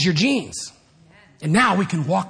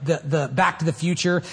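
normal talking voice

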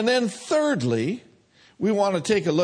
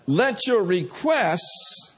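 ordinary talk

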